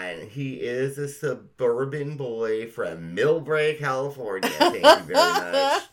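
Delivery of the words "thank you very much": high, loud, laughing "thank you very much"; laughing mock sign-off. Hosts joking about a suburban rock star, high and laughing. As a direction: toss it off with a big laugh.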